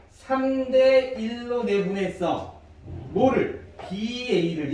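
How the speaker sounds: slow, drawn-out "삼 대 일로" with a firm "뭐를?"; demonstrated reading. Shown as a demonstration. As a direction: read it out slowly for students to follow. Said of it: "이렇게 읽으셔야 돼요".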